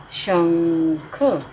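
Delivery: शंख drawn out very slowly with the nasal held; slow sounding out. Shown as a demonstration. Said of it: "छोटे अ की मात्रा ख".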